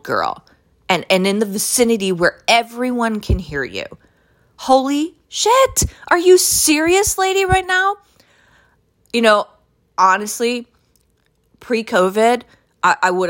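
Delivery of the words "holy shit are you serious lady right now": can't believe what you're hearing; stunned disbelief. Real, not acted. Disbelief at woman's loud talk.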